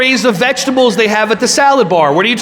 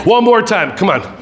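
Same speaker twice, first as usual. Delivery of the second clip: usual speaking voice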